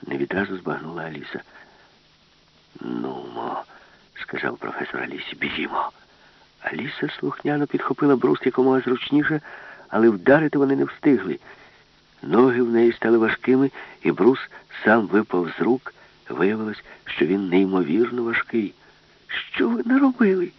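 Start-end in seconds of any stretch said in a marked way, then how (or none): 2.8-5.9 low hushed urging; whispered urging. A narrator's reading. Professor whispers to Alice. 19.3-20.5 high alarmed cry. A narrator's reading. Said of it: "крикнула Аліса".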